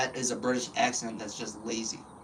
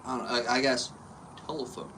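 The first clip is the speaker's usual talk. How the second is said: ordinary talk, nothing marked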